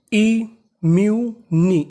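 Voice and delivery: ई mu नी said slowly, each syllable separate; slow spelling out. Shown as a demonstration. Teacher sounding out ई mu नी syllable by syllable. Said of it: "तो meaning".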